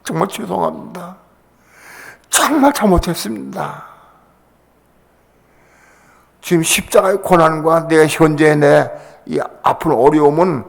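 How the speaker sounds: a preacher reliving his weeping prayer; tearful prayer. Retold or imitated. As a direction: cry out an apology to God. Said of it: "막 통곡하고 울었어요".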